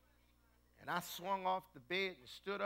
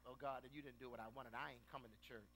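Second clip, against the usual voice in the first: pouting; 5 semitones lower, 14 dB quieter, faster than usual. Retold or imitated. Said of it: "We pout".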